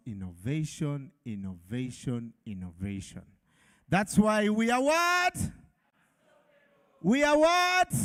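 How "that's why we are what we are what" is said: high, rising call with a long held last word; call-and-response prompt. Real, not acted. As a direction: prompt the crowd to answer back, pitch soaring. MC prompts crowd to answer back.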